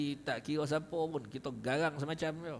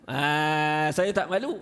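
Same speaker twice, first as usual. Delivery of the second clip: "saya tak malu" said louder and brighter, flaunting it; mock shameless boast; 7 dB louder, slower than usual. Teacher mocking a shameless person, louder proud boast. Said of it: "Mana dia kata".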